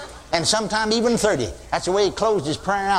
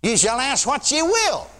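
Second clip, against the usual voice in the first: slow, lifted preaching read; scripture reading; 8 semitones higher than usual. Acted. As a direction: intone the verse slowly.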